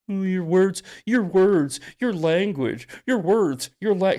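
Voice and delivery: high whiny mock voice; prissy sneering impression; mocking whiner. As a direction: parody the tone-policing crowd. Mocking critics of his language.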